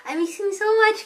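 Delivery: high, loud gushing; longing gush. Real, not acted. Missing her fluffy cat in Bulgaria, high loud gush.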